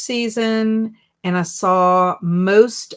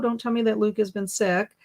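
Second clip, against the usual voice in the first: usual speaking voice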